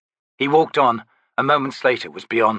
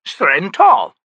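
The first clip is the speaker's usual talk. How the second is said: lofty, theatrical, higher; grand old Daru; 8 semitones higher, 8 dB louder than usual. Acted. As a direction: play the eccentric old trickster. Naming relics.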